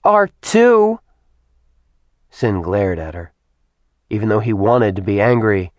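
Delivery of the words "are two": high, loud imitation of a small child; put-on childish squeal of contradiction; juvenile tone. A narrator's reading. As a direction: taunt like a bratty toddler. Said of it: "in the most juvenile tone she could muster".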